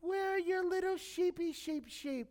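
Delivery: high, mocking sing-song taunt; mocking big brother, high and sneering; sarcastic ridicule. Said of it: "you can see the ridicule, the sarcasm".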